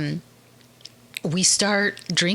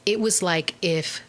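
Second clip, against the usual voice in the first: ordinary delivery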